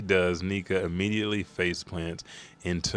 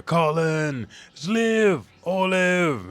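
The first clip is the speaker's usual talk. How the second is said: much higher, loud calling of names; 9 semitones higher, 6 dB louder, much slower than usual. Retold or imitated. Parent calls out for the missing children, loud and high. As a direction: shout the names across a distance.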